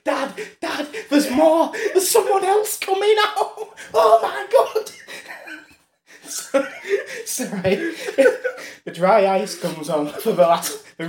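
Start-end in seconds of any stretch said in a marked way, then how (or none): 0.0-4.9 put-on excited little kid. Little kid to his dad at the circus, high excited squeal. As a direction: squeal like an overexcited child. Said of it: "He's like".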